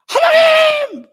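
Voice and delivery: a high, loud, drawn-out cry of 하나님; a desperate cry lifted up to God. Acted. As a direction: call 하나님 out, slow and very high. Preacher cries out to God.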